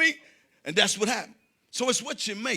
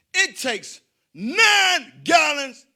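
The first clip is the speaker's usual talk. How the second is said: drawn-out loud emphasis; slow emphatic setup; 8 semitones higher, 9 dB louder, much slower than usual. Real, not acted. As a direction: stretch each word for suspense. Setting up riddle slowly.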